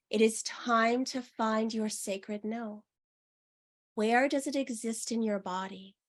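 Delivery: unhurried meditation guide; guided meditation. Acted. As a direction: lead them gently into the body.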